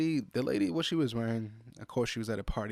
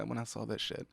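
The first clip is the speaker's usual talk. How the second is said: ordinary delivery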